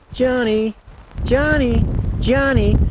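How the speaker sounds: high, sliding down at the end; high to low slide. Shown as a demonstration. Teaching elk callers the smooth high-to-low mew. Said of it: "it's this high to low thing".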